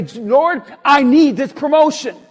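loud, insistent litany of demands; demanding mock prayer. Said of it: "I'm gonna pray and I'm gonna say".

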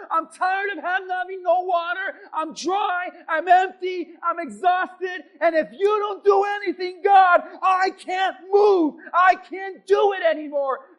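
high, full-voiced desperate shout; crying out to God. Moses at the wall pleading with God, desperate shouted cry. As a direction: hammer out each complaint as a loud, exhausted plea. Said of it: "This is Moses crying. This is Moses saying".

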